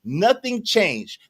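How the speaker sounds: slow, deliberate "Nothing changed."; said slowly. Shown as a demonstration. Explaining slowly to a co-host who misses the point, deliberate. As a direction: spell out each word for someone who isn't getting it. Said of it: "let me say it slow".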